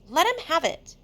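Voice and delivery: high, loud indignant cry; shout. Acted. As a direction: yell over the laughing crowd. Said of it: "shouted Piggy".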